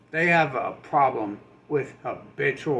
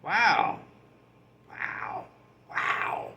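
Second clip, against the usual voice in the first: fading awed wow; 4 semitones higher, much slower than usual. Retold or imitated. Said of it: "it's like".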